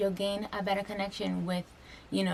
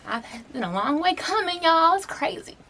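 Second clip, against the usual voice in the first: much higher, louder cry of "y'all"; overwhelmed; 10 semitones higher, 6 dB louder than usual. Real, not acted. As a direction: let the emotion burst out. Overwhelmed by her own journey.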